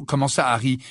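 ordinary delivery

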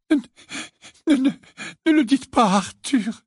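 high, stammering tearful plea; hiccuping sobs. A narrator's reading. Missus Wesley dabs her eyes, hiccuping sobs. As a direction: hiccup the words out between sobs. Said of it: "octa Missus Wesley".

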